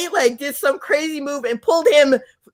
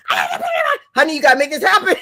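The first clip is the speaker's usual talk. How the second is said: frantic pleading; 3 semitones higher, much faster than usual. Retold or imitated. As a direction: beg him, giggling and rushed. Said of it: "I'm just shaking him".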